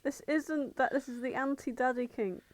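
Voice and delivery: usual speaking voice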